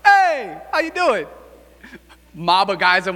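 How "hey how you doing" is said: mock friendly greeting. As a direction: greet a mob like old pals, high and bright. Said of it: "I'm just gonna be like".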